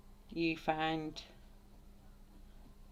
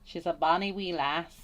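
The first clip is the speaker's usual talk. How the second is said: louder Scottish lilt that sounds Irish; attempted Scottish accent; 6 dB louder than usual. Painter having another go at Scottish, Irish-tinged accent. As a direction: put on a shaky Scottish accent. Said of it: "I think that sounds Irish".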